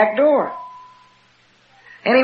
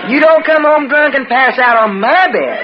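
higher, forceful retold tirade; angry scolding; 5 semitones higher, 4 dB louder than usual. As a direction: lay into a drunk husband. Retold quarrel with drunk husband.

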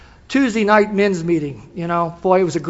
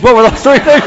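repeated louder and higher with a laugh; eager repeat; 6 semitones higher, 10 dB louder than usual. Retold or imitated. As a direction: press the question again, laughing. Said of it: "people come up and go".

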